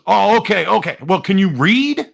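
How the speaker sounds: imitated New Jersey boss, pitched high; put-on New Jersey needling; New Jersey accent. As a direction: size up the newcomer in New Jersey style. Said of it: "he had a New Jersey accent".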